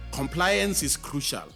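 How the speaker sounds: normal talking voice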